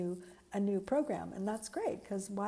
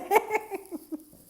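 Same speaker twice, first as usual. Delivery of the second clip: real laugh, much higher and louder; high, loud burst of laughter; 13 semitones higher, 9 dB louder than usual. Real, not acted. Bursts out laughing about crying.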